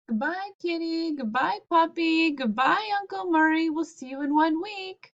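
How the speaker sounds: high, bright sing-song goodbyes; cheery parents' farewell. Acted. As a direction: wave them off sweetly.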